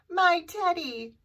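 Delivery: high, loud storybook exclamation; cried out. A narrator's reading. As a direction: call out as the boy finding his teddy. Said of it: "cried Eddie".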